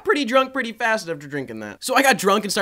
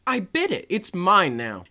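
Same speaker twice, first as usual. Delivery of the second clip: ordinary talk, nothing marked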